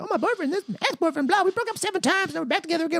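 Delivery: very high, mock girly babble; nonstop chatterbox. Imitating a seatmate who talked the whole flight, high-pitched nonstop babble. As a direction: rattle on in a squeaky gossip voice. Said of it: "just talked nonstop".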